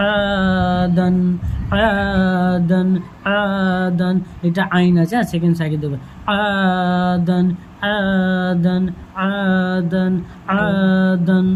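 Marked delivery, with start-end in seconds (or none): 0.1-4.2 আদান with a long, level আ each time; stretched madd. Shown as a demonstration. Teacher drilling the one-alif stretch, long আ in আদান. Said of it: "এক আলিফ টান হবে". 6.4-11.5 আদান chanted evenly with a long first আ; stretched madd. Shown as a demonstration. Teacher repeating the drill after explaining, long আ in আদান. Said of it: "এক আলিফ টান হবে".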